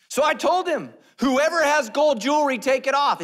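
high, very loud self-justifying tale; hammed-up shouting. Aaron explaining himself to Moses, hammed-up shouting. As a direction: shout the excuse in full comic force.

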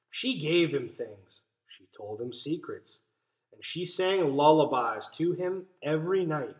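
put-on soft, gentle read-aloud to children; story reading. A narrator's reading. Teacher reading a picture book to her class, soft and gentle. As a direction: read it softly like a bedtime story.

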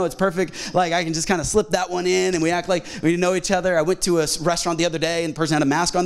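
normal talking voice

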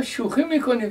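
retold disbelief, pitch leaping up; disbelieving. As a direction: ask it half-laughing in surprise. Singer disbelieves the song news.

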